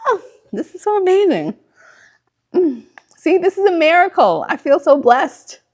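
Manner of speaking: high, laughing gush of delight; overjoyed. Real, not acted. Host thrilled the stream ran smoothly, laughing. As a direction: bubble over with happiness. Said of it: "I just could cry".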